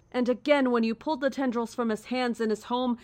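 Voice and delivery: usual speaking voice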